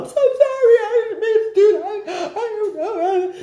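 imitating a friend weeping her sorry; retold sobbing apology. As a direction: break down as you apologize. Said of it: "she broke down".

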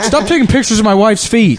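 hammed-up protest; mock indignation. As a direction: play an indignant husband for laughs. Acted tourist scolding a foot fetishist.

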